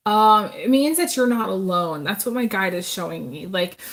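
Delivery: ordinary talk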